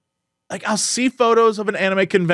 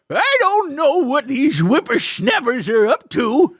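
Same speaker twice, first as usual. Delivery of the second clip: put-on higher creaky old-timer voice; old grunty man; 4 semitones higher than usual. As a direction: grumble like a cranky old hermit. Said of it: "what was that voice?".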